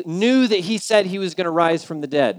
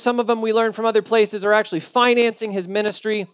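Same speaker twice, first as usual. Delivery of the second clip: ordinary delivery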